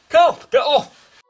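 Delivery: slow, loud panicked yell; panicked shout. Acted. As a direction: shout to shake someone off. Being pulled, shouting for help.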